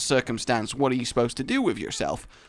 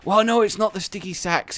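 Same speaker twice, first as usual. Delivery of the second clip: normal talking voice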